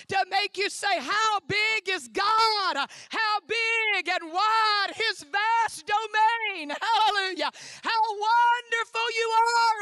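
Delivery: loud, high, rolling preacher's cadence; full-force preaching. Acted. Preacher exhorts the women's conference, loud and fervent. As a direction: preach it, lift the room.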